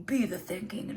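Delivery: "Be the thinking" said in a strange put-on way; a weird slipped take of "Be the thinking" that she laughs at; odd delivery. Shown as a demonstration. Fumbling the card message, odd put-on delivery. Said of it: "I don't know why I said it like that".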